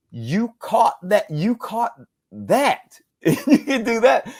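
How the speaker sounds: high, drawn-out disbelief; amazed. Retold or imitated. Father to four-year-old who picked up an accent, amazed. Retold amazement at young son.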